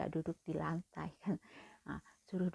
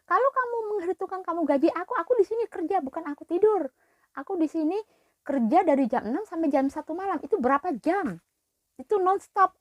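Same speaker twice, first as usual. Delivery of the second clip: higher, louder fed-up tirade at the boss; angry retort; 6 semitones higher, 9 dB louder than usual. Retold or imitated. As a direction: pour out every grievance. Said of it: "Aku berani". (luapan marah kepada majikan)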